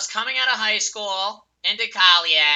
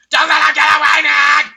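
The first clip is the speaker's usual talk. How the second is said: higher, louder urgent yell; urgent shout; 8 semitones higher, 12 dB louder than usual. Real, not acted. Gamer urging team not to let enemy flee, urgent shout. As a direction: shout so he can't escape.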